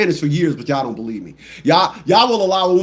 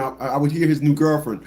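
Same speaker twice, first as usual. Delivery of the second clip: ordinary talk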